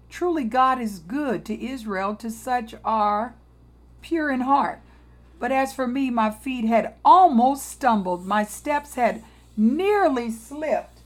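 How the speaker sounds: solemn preacher's scripture reading. Acted. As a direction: read the verses with weight. Reads Psalm aloud.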